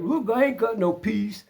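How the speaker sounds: high-pitched mock protest; imitated agitated neighbor. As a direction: mimic a worked-up man protesting. Acts out neighbor lifting his shirt.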